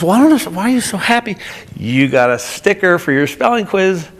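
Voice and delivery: mom pitching up in bewilderment; baffled mom. Retold or imitated. Mother quizzing her happy daughter, high and baffled. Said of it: "And she says".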